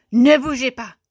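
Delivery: sharp, very loud command; teacher's yell. A narrator's reading. Teacher orders pupils to stay still, loud yell. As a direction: yell it to freeze the whole class. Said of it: "La maîtresse hurle".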